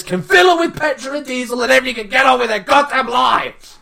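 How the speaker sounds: exasperated shout. Retold or imitated. Retold telling off.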